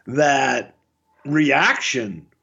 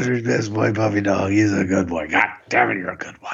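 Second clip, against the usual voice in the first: doggy talk; 6 semitones lower than usual. Acted. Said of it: "that's doggy talk".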